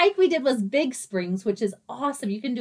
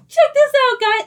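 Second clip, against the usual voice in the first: loud, high call to the group; excited call-out; 13 semitones higher, 9 dB louder than usual. Retold or imitated. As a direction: call everyone over, thrilled. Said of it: "And she's like".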